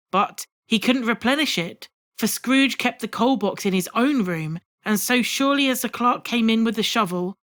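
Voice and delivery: ordinary delivery, nothing marked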